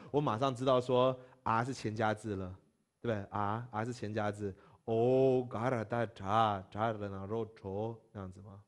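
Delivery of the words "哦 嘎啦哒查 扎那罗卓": drawn out letter by letter; slow spelling out. Shown as a demonstration. Teacher repeating the spelling, slow. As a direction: spell each part slowly.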